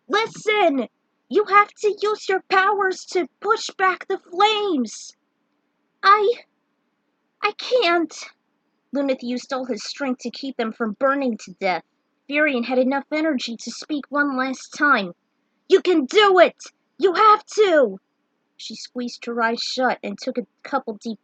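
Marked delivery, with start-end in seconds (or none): 0.1-5.2 high, slow pleading shout; urgent yell. Acted. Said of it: "and yelled". 6.0-8.1 high, broken, frightened cry; terrified refusal. Acted. Tara too frightened to cast. 15.7-18.0 loud, high pleading shout; desperate urging. Acted. Firion's last urging to Tara.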